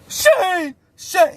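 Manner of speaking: high calling yell; high shout of a name for help; yelling for his homie. Retold or imitated. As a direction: call out for backup across the pod. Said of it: "He's yelling for his homie".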